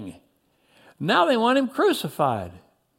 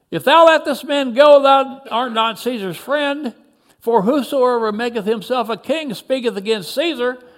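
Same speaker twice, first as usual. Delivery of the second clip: high, raised warning; shout; 5 semitones higher, 5 dB louder than usual. A narrator's reading. One of the crowd warns Pilate, shouting. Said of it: "Another shouted".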